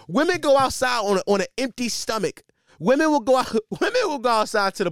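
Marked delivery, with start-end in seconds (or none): none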